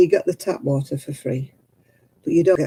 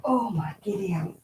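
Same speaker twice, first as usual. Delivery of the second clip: breathy under-the-breath whisper; hushed surprised whisper; whispered exclamation; 3 semitones higher, 7 dB quieter than usual. Real, not acted. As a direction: mutter it to yourself in a whisper. Startled aside under her breath.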